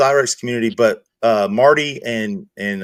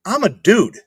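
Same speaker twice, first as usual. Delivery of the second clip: exasperated; 8 semitones higher than usual. Retold or imitated. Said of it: "I'm like".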